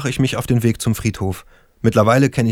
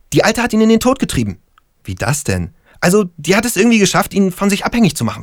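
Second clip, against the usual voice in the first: higher, insistent, sure of his theory; conspiracy-minded stoner; 6 semitones higher than usual. Acted. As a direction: convince a doubting friend.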